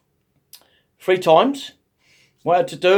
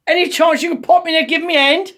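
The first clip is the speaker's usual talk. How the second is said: exasperated plea; 10 semitones higher, 3 dB louder than usual. Retold or imitated. Flustered man calls to nurse.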